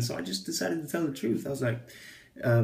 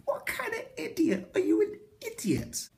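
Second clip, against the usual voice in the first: much higher, incredulous voice; mock disbelief. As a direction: squeal the question in mock outrage. Mock disbelief at own retort.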